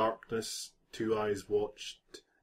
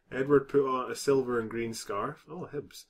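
ordinary talk

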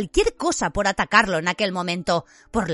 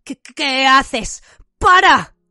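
stammering, high, loud panic; stutter, then burst into a loud cry; terrified voice; 5 semitones higher, 7 dB louder, much slower than usual. A narrator's reading. Dudley suddenly in total darkness, terrified stammer. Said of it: "La aterrorizada voz de Dudley".

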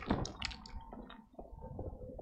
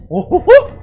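monkey hoots; 9 dB louder, slower than usual. Pretend. Imitating monkey noise.

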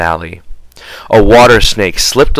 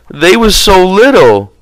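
higher, pleading little voice; apologetic; 10 semitones higher, 4 dB louder than usual. A narrator's reading. Lenny explaining to George, apologetic. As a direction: say it like a sorry child. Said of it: "he said apologetically".

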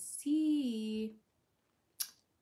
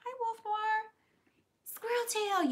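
pet talk; 12 semitones higher, 7 dB louder than usual. Real, not acted. Greeting a viewer's pet.

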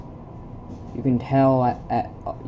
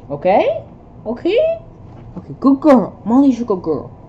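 much higher, sing-song cooing; pet talk; 9 semitones higher, 6 dB louder than usual. Real, not acted. Owner praising his dog Molly, high cooing.

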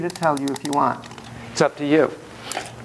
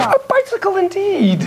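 excited; 13 semitones higher than usual. Acted. Echoing child's words playfully.

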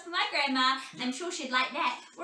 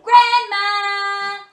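loud sing-song call, much higher; 4 semitones higher, 13 dB louder than usual. Acted. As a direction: call Grandma from outside the house. Calling out on arrival.

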